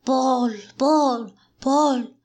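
llamada aguda y repetida, como un rugido. A narrator's reading. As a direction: rugir el nombre en tono más alto. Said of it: "manoteando y rugiendo".

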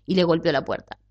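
plain reading voice

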